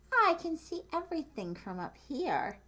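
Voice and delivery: a small, high voice full of wonder; Mouse's thought. Acted. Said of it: "what she thought".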